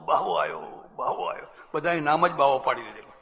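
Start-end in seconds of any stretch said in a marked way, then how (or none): 0.1-0.6 બાવો આયો dropped very low; deep repeat. Retold or imitated. Child's call repeated very low. 1.0-1.5 બાવો આયો in a bare whisper; whispered repeat. Retold or imitated. Child's call echoed in a whisper.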